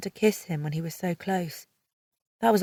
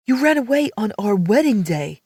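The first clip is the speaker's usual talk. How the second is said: louder male voice, accusing; angry accusation; 3 semitones higher, 6 dB louder than usual. A narrator's reading. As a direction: throw the accusation at her. Riggs confronts runaway bride.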